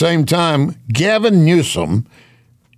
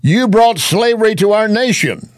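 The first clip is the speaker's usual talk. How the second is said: raised, higher-pitched imitation of protesters; mock yelling; 8 semitones higher, 3 dB louder than usual. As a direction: call it out like an indignant crowd objecting. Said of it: "I can hear many yelling".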